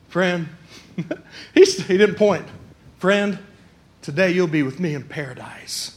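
soft, low, gentle promise; tender Jesus. Acted. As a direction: speak gently to the dying criminal. Jesus gently speaks to the criminal.

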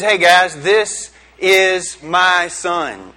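drawn-out proud father. Acted. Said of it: "the father identifies him and says".

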